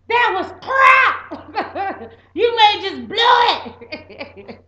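slow, loud barked orders, laughing; hammed-up drill sergeant bark. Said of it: "like a drill sergeant".

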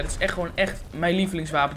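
normal talking voice

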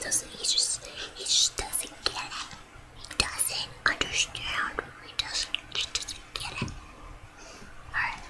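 slow hushed whisper, fading away. Real, not acted. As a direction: whisper it as an aside. Whispering about the dog.

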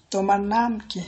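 ordinary talk, nothing marked

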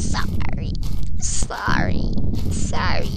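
soft breathy sorry, voice gone; whispered apology. Real, not acted. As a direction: whisper sorry after the scream. Apologizing after screaming.

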